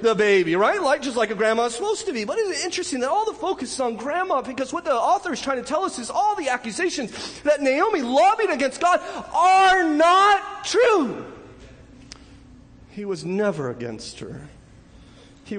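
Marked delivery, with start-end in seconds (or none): 0.0-11.2 impassioned preaching. Acted. Preacher defending God against Naomi's accusations, impassioned and high. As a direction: preach it high and hard, landing emphatically.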